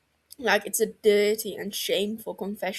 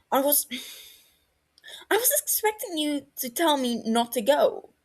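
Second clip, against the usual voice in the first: weak argument; 6 semitones higher than usual. A narrator's reading. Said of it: "he argued weakly".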